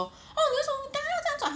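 put-on voice of a peeved girlfriend; mock irritated question. Girlfriend questioning boyfriend's driving, irritated put-on voice. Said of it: "这样子说".

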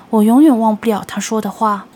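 ordinary delivery, nothing marked

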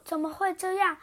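usual speaking voice

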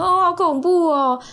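slow, high, drawn-out mock fear; mock spooked. Friend hearing the marriage answer, slow mock fright. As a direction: stretch it out like a spooked teen.

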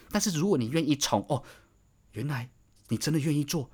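usual speaking voice

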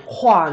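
roi said slowly on its own in French, as xwa; French demo of roi; French word roi. Shown as a demonstration. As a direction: say roi as one clear French syllable. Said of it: "galette de roi呢，roi".

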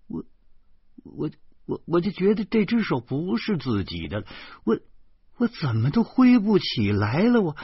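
stammering, pitched high; flustered distress. A narrator's reading. Narrator unable to wave goodbye, flustered and high. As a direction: stumble on the first word, then rise high in distress.

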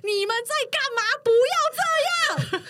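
high mock shout to break it up; hammed-up yell of the man pulling them apart; male coworker yelling. Coworker pulling the fighters apart, mock yelling stop. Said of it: "他说".

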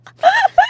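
squealing laugh at her joke; high laugh. Real, not acted.

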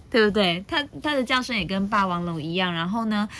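plain reading voice

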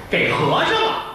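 put-on high, innocent child's answer. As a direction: blurt it out like a little kid. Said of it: "小孩儿说话".

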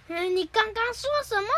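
high, affronted cartoon challenge; mock indignation. Gian confronts Suneo over the insult, indignant.

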